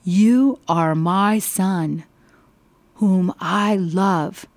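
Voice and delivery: a voice from the sky, spaced and solemn; slow, booming blessing from heaven. Acted. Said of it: "the voice of heaven called out".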